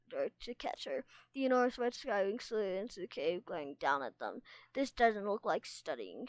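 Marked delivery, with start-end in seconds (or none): none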